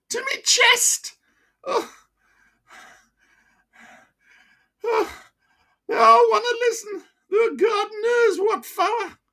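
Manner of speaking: put-on high, broad Wearside voice of an old miner; chatty old pitman with a wheezy chest. Old miner to his doctor, broad Wearside.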